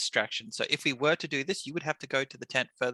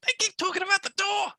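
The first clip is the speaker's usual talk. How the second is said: exasperated; 4 dB louder than usual. Real, not acted. Excited insistent argument.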